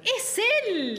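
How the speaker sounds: high, sharp burst of shock; it's HIM, spit it out in disbelief; shocked recognition. Acted. Spotting her boyfriend kissing someone else, shocked.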